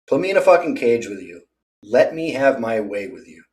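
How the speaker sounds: ordinary talk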